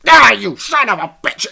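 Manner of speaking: imitating her angry outburst. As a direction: blurt it out as she lunges at him. She attacks deepfaking boyfriend.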